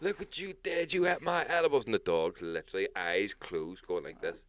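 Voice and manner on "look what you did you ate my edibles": high-pitched retold scolding at the dog; mock angry owner. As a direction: imitate a furious owner yelling, pitched up. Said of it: "they were shouting".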